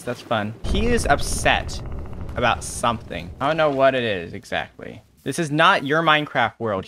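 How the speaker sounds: normal talking voice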